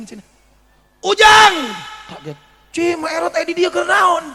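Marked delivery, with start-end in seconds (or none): 1.0-1.5 calling loudly across a crowd, much higher and louder; granny spotting her neighbour's boy; shouted name. Acted. Old woman calls out to Ujang. 2.7-4.3 higher, louder surprised question; surprised young man. Acted. Ujang surprised, voiced.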